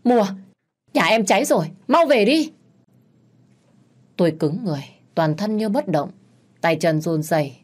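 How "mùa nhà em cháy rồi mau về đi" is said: higher and louder, panicked yelling down the line; a man yelling that the house is on fire; frantic phone shout. A narrator's reading. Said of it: "tiếng gào thét của anh Lý".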